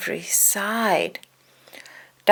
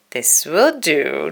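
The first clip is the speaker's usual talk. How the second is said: sly grin; slower than usual. A narrator's reading. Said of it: "Donald grinned".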